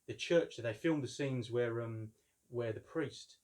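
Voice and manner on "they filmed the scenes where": usual speaking voice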